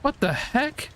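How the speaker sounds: high, startled what the heck; a high, startled cry of disbelief; startled outburst. Real, not acted. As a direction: jolt up in sudden shock. Sudden shock at fire.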